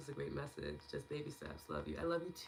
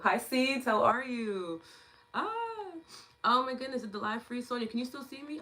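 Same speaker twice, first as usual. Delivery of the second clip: ordinary talk, nothing marked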